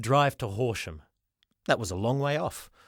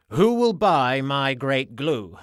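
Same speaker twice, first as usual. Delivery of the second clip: loud, slow showman call; calling out to a crowd; 2 semitones higher, 6 dB louder, slower than usual. Acted. Giffen addresses cheering crowd.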